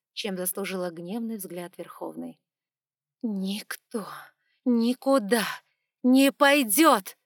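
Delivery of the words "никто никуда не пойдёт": each word hammered out, slow; staccato. A narrator's reading. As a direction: rap out every word separately. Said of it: "отчеканила она".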